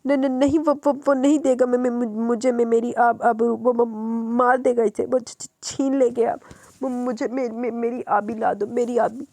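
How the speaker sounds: slow, stammering, broken pleas; delirious stammering. Acted. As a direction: beg for his daughter between stammers. (हकलाती, टूटी हुई गुहार)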